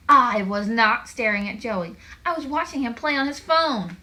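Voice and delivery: loud, emphatic denial; very upset. A narrator's reading. Greta denies staring at Joey, very upset. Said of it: "She was very upset about that".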